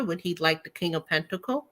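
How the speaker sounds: ordinary talk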